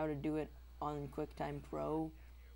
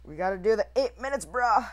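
loud, quick insistence; hyped exclamation; 4 semitones higher, 11 dB louder than usual. Real, not acted. Player pushing for eight minutes, loud hyped exclamation. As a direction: push it out loud and fast.

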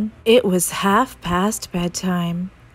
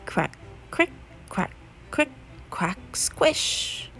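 whispered crick-crack footsteps; 7 dB quieter than usual. Acted. Footsteps sound effect.

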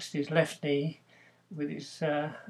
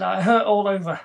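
pained complaint; 5 semitones higher, 11 dB louder than usual. Retold or imitated. Patient complains to doctor by phone.

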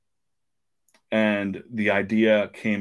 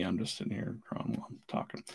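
fast, whispered, much softer; mumbled aside; 13 dB quieter, faster than usual. Real, not acted. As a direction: toss it off under your breath. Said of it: "Never mind me".

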